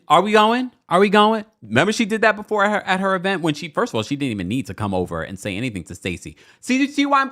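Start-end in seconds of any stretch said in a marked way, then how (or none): 0.1-1.4 loud, pushy repeated question; impatient Giselle. Retold or imitated. Giselle barging in on crying Angel, loud and pushy. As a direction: rush everyone out the door. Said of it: "Giselle comes on in".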